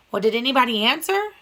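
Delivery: usual speaking voice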